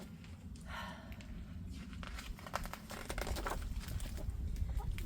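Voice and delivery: long soft exhale; slow breath out. Acted. Duck breathing out while meditating, long soft exhale. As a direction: let the breath out slowly. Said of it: "Duck breathed out slowly and deeply".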